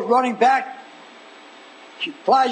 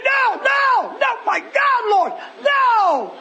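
very high, hammed-up cries of no; dramatized protest; 11 semitones higher, much slower than usual. Martha protesting at the tomb, dramatized protest. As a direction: cry out in over-dramatic horror. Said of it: "Am I dramatizing too much".

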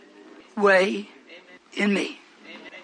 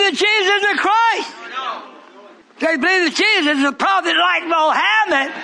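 preaching at full force; 6 semitones higher than usual. Acted. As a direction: thunder it out to the congregation.

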